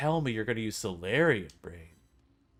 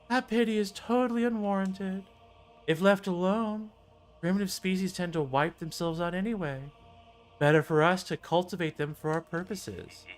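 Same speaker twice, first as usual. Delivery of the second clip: high, dismissive schemer; cold schemer voice; 7 semitones higher than usual. Acted. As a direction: brush off the pity coldly.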